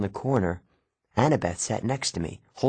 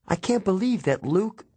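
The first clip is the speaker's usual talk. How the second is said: much higher, faltering voice; faltering disbelief; 11 semitones higher than usual. A narrator's reading. Girl learning of a friend's betrayal, faltering. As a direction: let the disbelief falter and break off. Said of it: "Annabeth's voice faltered".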